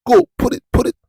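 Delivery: high, insistent command in the head; urgent inner voice. Acted. The calling tells the preacher to post it, urgent. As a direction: push with a loud, high-pitched urging.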